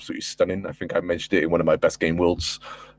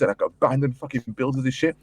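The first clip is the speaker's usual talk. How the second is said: ordinary talk, nothing marked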